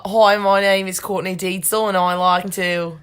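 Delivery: put-on Australian self-introduction; best Australian accent. Shown as a demonstration. Answering a request to show off, Australian accent. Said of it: "say your best Australian accent".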